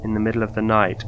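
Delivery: usual speaking voice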